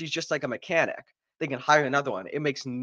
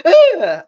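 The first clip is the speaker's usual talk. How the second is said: high, loud mock cry; mock yell; 13 dB louder than usual. Reviewer acting out the fight, high mock yell. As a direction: yelp it out in a much higher voice. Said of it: "and she's like".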